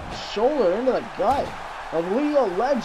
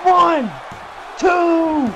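shouted count; 7 semitones higher, slower than usual. Acted. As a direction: count the pin at full voice. Calling the pinfall count.

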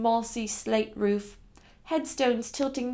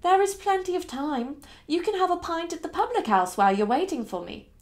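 higher, lilting coaxing voice; patient young mistress; 6 semitones higher than usual. Acted. Young lady offering her driver a pint, high coaxing voice. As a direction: talk him round in a raised lilt.

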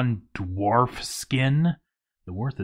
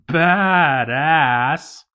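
a burst of pride, louder; excited exclamation; 4 semitones higher, 7 dB louder than usual. Real, not acted. Player cheering his new fort title, excited. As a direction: cheer it like you just leveled up.

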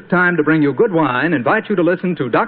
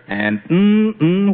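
appreciative hum of taste; savoring mm-mm; 3 semitones higher than usual. Acted. Announcer relishing the wine's flavor with a hum. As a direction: hum like you just tasted something delicious.

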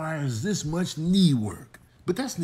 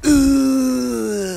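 fake dying groan; 9 semitones higher, 11 dB louder than usual. Playing dead.

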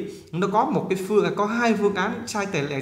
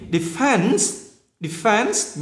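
"defense" with a high, drawn-out second syllable; nhấn âm hai; 5 semitones higher, much slower than usual. Shown as a demonstration. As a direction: keep "de" short, lean on "fense". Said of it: "nhấn năm hai". ("defense" nhấn mạnh âm hai)

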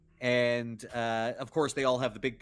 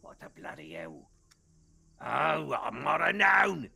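Scudge grumbling; 4 semitones higher, 6 dB louder than usual. Acted. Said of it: "Scudge just go".

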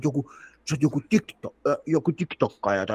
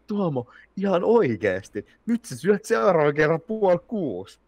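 mimicking girlfriend; 8 semitones higher, 3 dB louder than usual. Girlfriend scolding his eating.

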